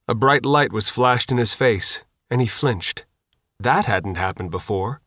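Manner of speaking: ordinary delivery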